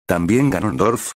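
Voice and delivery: ordinary delivery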